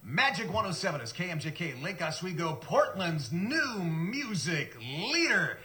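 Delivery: DJ announcer voice; top ten countdown. Retold or imitated. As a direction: punch it like a top forty DJ. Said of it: "I crack up the microphone".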